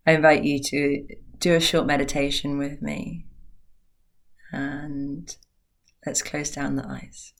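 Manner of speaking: soft, unhurried meditation voice with long pauses; guided meditation. Acted. Teacher guiding listeners into a short meditation, hushed and calm. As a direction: ease them gently into stillness, very softly.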